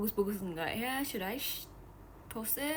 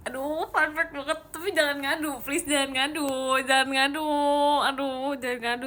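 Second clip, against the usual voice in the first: playful pleading; 4 semitones higher, 6 dB louder than usual. Real, not acted. Pleading with chat not to tell.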